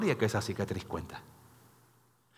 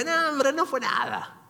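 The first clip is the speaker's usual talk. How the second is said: mock dismissal; 9 semitones higher than usual. Mimics what Joseph never said.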